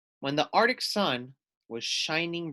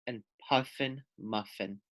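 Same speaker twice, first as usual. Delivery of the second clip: plain reading voice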